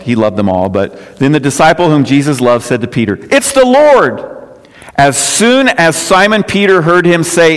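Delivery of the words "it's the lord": high exclamation of sudden recognition; excited cry. Acted. Disciple's excited cry to Peter.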